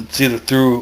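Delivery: ordinary delivery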